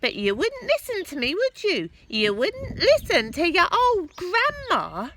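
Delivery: put-on high, loud gloating old woman; grandma bragging loudly from the roof; boastful Grandma. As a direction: crow about your magic powers. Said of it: "she answered".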